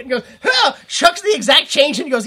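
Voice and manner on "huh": mock grunt. Hero grunt.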